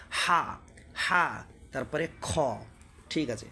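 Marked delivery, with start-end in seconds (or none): 0.1-1.4 হা pushed out from the throat. Shown as a demonstration. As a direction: model হা as a long, throaty "haa". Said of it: "গলা থেকে".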